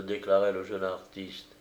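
ordinary delivery, nothing marked

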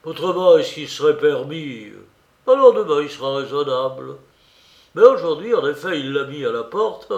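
loud, tipsy ranting; drunken voice. A narrator's reading. Razoumikhine drunkenly ranting to the two ladies. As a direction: boom it out like a man who has drunk too much. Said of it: "d'une voix qui trahissait l'ivresse".

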